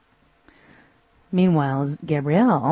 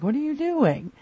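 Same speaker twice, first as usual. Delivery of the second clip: incredulous question; 11 semitones higher than usual. Retold or imitated. Xena puzzled by Gabrielle's strut.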